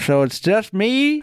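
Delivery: put-on high, slow quavering old miner; old prospector. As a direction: whine it like a lonely old miner. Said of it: "guy, prospector".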